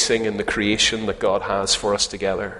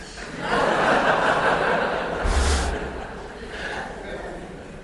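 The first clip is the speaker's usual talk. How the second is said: airy laugh high up; high breathy laugh; 10 semitones higher than usual. Real, not acted. Preacher laughs at his own Skye punchline, breathy.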